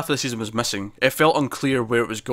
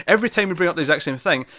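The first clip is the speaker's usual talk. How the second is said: normal talking voice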